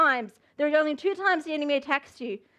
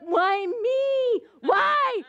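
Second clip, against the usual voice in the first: high, drawn-out whine of self-pity; hammed-up poor-me complaint; pity party; 3 semitones higher than usual. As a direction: wail it like a mock sufferer. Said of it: "in a pity party being like".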